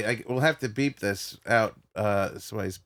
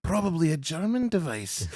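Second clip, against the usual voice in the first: lightly put-on Scottish comic musing; Billy Connolly; 5 semitones higher than usual. Comedian imagining a pain-measuring device, lightly voiced Scottish impression. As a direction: speculate as a Scottish stand-up, punching the invented word. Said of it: "Billy Connolly talk about the stonefish".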